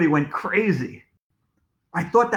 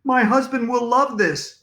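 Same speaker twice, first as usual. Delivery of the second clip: high-pitched delighted mom voice; eager mother at photo time; excited mom; 8 semitones higher, 3 dB louder than usual. A narrator's reading. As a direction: gush it in a higher voice.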